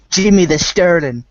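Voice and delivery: put-on a half-hearted gangster accent on the name; bad Mafia accent. Podcast host mocks Jim Sterling in a bad Mafia accent. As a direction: tosses off a quick, badly faked mobster voice. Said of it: "that was a terrible accent".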